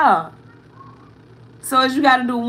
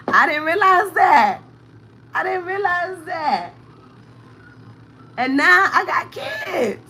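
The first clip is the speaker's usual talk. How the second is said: real tears pushing through the words; choked-up, higher and strained; holding back tears; 6 semitones higher than usual. Real, not acted. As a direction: voice breaking high on the edge of crying. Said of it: "I'm trying not to cry".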